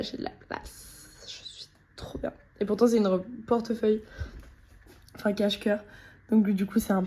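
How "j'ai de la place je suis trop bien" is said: whisper. Real, not acted. Delighted aside about dress.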